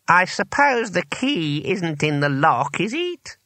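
high, slow, drawn-out inquiry; pompous old wizard. A narrator's reading. Old wizard asking about the key, pompous.

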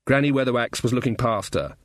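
usual speaking voice

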